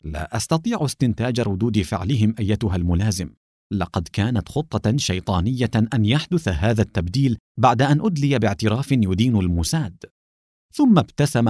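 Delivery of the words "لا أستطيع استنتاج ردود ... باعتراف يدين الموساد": ordinary delivery, nothing marked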